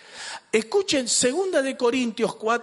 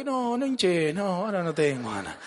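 mildly grumbling, sinking tone; reluctant brush-off. Acted. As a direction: wave the request away, trailing down. Acts out an unwilling churchgoer. (con desgana, quejoso)

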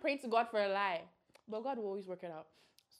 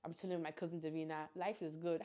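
ordinary talk, nothing marked

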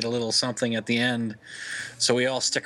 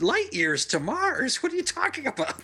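incredulous; 13 semitones higher than usual. Retold or imitated. Mocking the show's nonsense.

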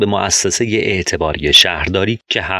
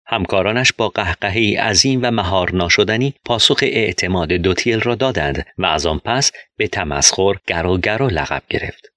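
ordinary delivery, nothing marked

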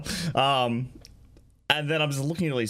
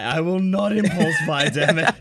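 laughing; 3 semitones higher, 5 dB louder than usual. Real, not acted. Vow repeated, now laughing.

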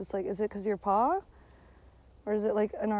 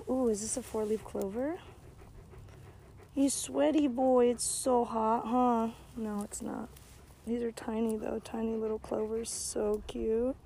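soft cooing; 8 dB quieter than usual. Real, not acted. Cooing over clovers and dog.

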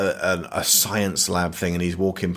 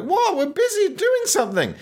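squeaky, much higher mock Tom voice; Tom's mock reaction. Mocking Tom interrupted by the woman, squeaky high voice. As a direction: pitch it way up as a goofy Tom.

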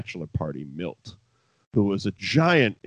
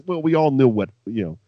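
ordinary delivery

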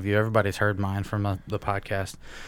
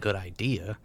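normal talking voice

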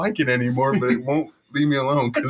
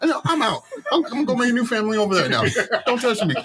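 high, fast, laughing brush-off; giddy brush-off; 9 semitones higher, faster than usual. Pretend. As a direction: bolt away, laughing. Dismissing family, fast high.

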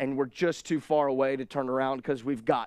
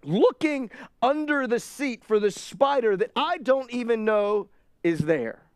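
ordinary talk

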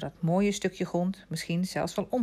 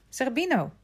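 raised-pitch summons; calling out; 7 semitones higher than usual. A narrator's reading. Vitalis summons the other dog, calling out. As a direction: call the second dog by name. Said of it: "riep hij".

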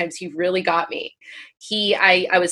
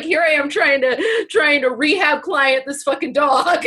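laughing through it; 6 semitones higher than usual. Real, not acted. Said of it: "started hysterically laughing".

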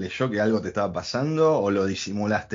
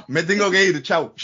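hurried steward exit; 5 semitones higher, 6 dB louder than usual. Retold or imitated. Listener voices the steward leaving.

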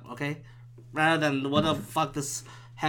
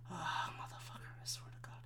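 barely audible whispered frustration; muttered curse; 2 semitones higher, 20 dB quieter than usual. Real, not acted. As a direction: swear to yourself in a whisper. Muttering after admitting he loses.

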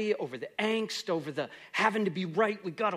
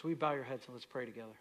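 softer, lower prayer voice; closing prayer; 4 semitones lower, 3 dB quieter than usual. Acted. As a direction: pray quietly for the church.

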